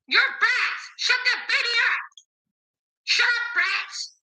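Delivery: high-pitched mock yell at kids, slowing at the end; retold officer's yell. Officer leaning in the car window screaming at the children, mimicked high yell.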